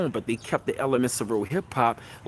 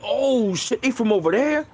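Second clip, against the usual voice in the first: surprised realization; 9 semitones higher than usual. Retold or imitated. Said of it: "we like".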